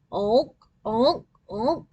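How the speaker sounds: nasal honking horn imitation; imitated honk; car horn. A narrator's reading.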